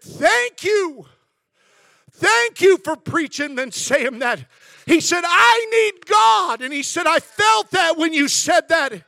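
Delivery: loud, choked-up thanks from a weeping stranger; emotional shout. Retold or imitated. Stranger in tears thanks the young preacher, loudly. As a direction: cry it out loud, slow and broken.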